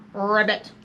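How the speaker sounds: low rolled-r ribbit; pig croaking like a frog. Acted. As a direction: imitate a frog, trilling the r. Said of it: "the little pig answered".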